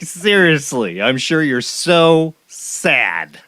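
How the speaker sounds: very slow, drawn-out mock pity; hammed-up fake concern; sarcastic sympathy. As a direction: stretch the words with heavy sarcasm. Mocking a rich man's sadness.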